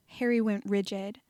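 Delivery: ordinary delivery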